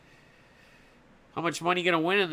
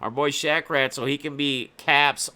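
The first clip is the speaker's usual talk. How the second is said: ordinary delivery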